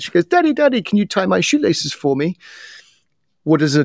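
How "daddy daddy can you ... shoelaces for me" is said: put-on high little-girl voice in American-British accent; daughter's American-British accent. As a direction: imitate a daughter pleading, very high then easing down. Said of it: "in her American British accent".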